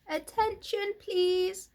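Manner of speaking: high, loud announcement call; loud, firm. A narrator's reading. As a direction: call out firmly to the whole barn. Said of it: "he said in a loud, firm voice".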